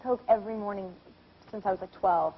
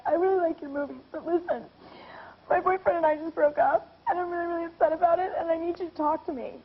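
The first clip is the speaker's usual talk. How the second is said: imitating tearful caller; 5 semitones higher than usual. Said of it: "this girl crying".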